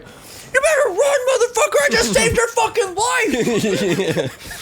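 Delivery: high, stretched-out shout; loud booming yell. Retold or imitated. Halfway out the window at the fleeing man, loud yell. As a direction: imitate a friend bellowing out a car window. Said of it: "he said it so loud".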